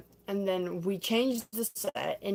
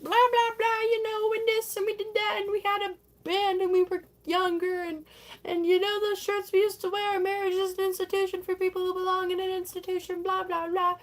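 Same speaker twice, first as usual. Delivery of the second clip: high-pitched mock prattle; hammed-up nostalgic babble; chattering old friends; 10 semitones higher than usual. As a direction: rattle on in a high silly voice. Imitating friends chattering about old times.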